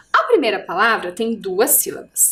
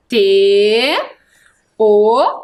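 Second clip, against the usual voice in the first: "T. O." as loud, held separate letters; letter-by-letter spelling; 3 semitones lower, 4 dB louder than usual. Shown as a demonstration. Teacher spelling tor for pupils, "T. O." loud and separate. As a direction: spell "T. O." loudly with a gap.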